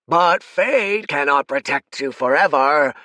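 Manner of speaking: loud menacing villain threat; demon king voice. Acted.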